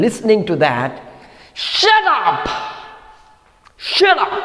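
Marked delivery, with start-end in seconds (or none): 1.5-4.3 high sharp yell; yelling shut up. Acted. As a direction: cut him off with a yell. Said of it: "Nomida yells".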